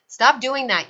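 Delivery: quarrelling sailor shouting at the other; angry shout. A narrator's reading. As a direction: snap at him to quit. Quarrel over the net.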